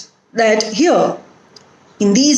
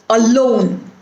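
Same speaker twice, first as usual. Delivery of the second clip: alone with weight on LONE; weak a, strong lone in alone; stress on second syllable. Shown as a demonstration. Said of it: "put the stress on the root word".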